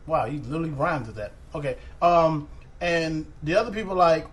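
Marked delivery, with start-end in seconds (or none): none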